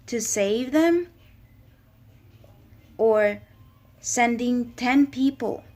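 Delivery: impassioned sermon swelling to a cry; rising fervour. Real, not acted. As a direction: build from firm to near shouting. Preaching building in fervour.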